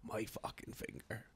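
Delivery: slow, barely voiced complaint; mock hurt. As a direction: act out pain in a hushed, drawn-out voice. Acting out whimpering over hurt finger.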